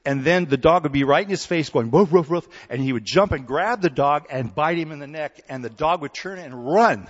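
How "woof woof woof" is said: loud, higher imitated dog barks; loud mock barking. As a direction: bark "Woof" hard right in his face. Dog in baboon's face barking.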